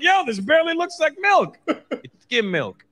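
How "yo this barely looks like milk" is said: higher, louder disbelief; incredulous. Retold or imitated. Kid at friend's house complaining about watery milk, incredulous. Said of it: "I'm like".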